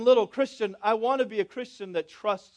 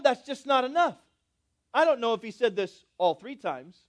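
normal talking voice

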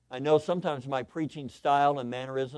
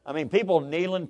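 usual speaking voice

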